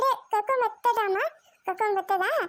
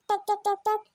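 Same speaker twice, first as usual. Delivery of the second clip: clip-clop noises. Acted. Child riding toy horse.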